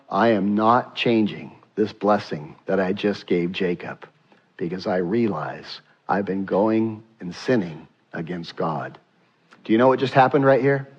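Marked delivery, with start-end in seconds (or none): 0.1-9.0 low, hushed admission; humbled confession. Acted. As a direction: drop low and soft, sinking as you confess. Isaac humbly confesses to his son.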